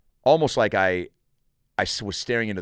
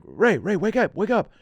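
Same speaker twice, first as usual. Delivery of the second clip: urgent wake-up call; 11 semitones higher, 4 dB louder than usual. Retold or imitated. Buddy shouting him awake.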